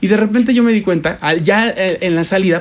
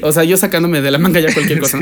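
ordinary delivery

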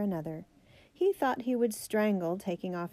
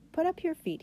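higher-pitched, mild command; ordering; 9 semitones higher than usual. A narrator's reading. Father telling his son to lift his boots, lightly ordering. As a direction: give a brisk little order. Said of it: "he ordered".

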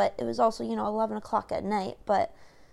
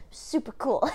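ordinary delivery

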